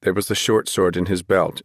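ordinary delivery

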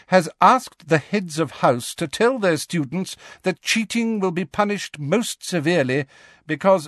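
high, crisp strict professor; stern teacher. Acted. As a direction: address the class with authority. McGonagall, disdain for headmistress.

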